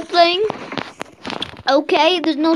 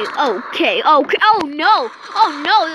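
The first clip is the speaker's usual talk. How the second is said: ordinary delivery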